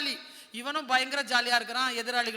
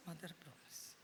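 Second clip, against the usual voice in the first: Mother promise said low and much softer, falling; soft oath; 5 semitones lower, 21 dB quieter than usual. Acted. Samson swears to Delilah, softly and low. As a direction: murmur the promise in a low fall.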